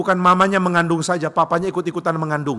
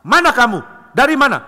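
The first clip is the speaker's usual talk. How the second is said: higher, louder furious demand; angry Cronus; 7 semitones higher, 5 dB louder than usual. Acted. Cronus angrily confronts his returning wife, loud and high. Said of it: "Cronus marah".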